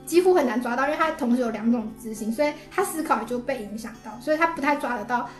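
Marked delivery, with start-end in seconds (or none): none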